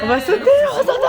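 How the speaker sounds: crié vite et très aigu, plein de joie; cri excité. Real, not acted. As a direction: hurle de joie en sautant. Excitation, ils sautent. (cri de joie excité)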